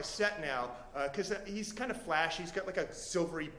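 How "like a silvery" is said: plain reading voice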